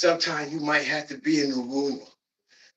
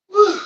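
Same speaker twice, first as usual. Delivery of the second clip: high loud whoop of effort; straining whoop; 14 dB louder than usual. Real, not acted. Working out, he whoops with effort. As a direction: let out a big whoop mid-rep. Exertion shout during workout.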